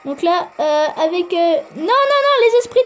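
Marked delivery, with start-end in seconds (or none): 1.8-2.8 high, panicked string of refusals; real mid-game panic, pitched up; alarmed protest. Real, not acted. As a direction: cry out in sudden alarm. Player sees troops being destroyed.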